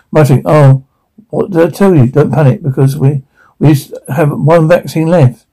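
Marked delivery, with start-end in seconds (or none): none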